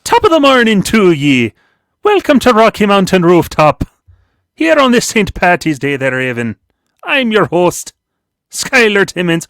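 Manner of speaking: high, loud stage Irish brogue; Irish accent. Pretend. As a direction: ham up an Irish host's welcome. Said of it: "Evan doesn't like my, uh, Irish accent".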